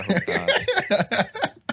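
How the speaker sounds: squeaky high laugh through the words, very slow. Real, not acted. Nervous laugh after singing.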